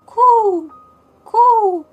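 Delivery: high, loud, long cooing; hooting high bird call; wood pigeon coo. A narrator's reading. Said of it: "Then the wood pigeon said".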